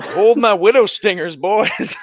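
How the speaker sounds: high, loud hammed-up heroine line; playful mock heroic voice; mock heroic quip. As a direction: toss it off like a cocky action hero. Joking quote of a character.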